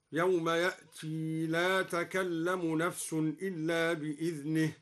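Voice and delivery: يأتي recited with the final ي kept in connected recitation, slow and measured; slow Quran recitation. Shown as a demonstration. Teacher shows students al-Duri's reading, slow recitation. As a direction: recite يأتي keeping its ي, unhurried. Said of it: "هكذا".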